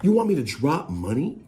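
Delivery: high-pitched, indignant imitation; imitated high-voiced rant; mock going off. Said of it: "he's just going off like".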